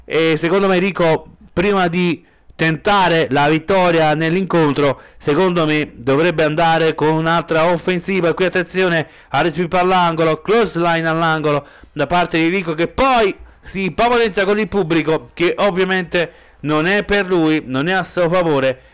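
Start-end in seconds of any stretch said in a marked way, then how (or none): none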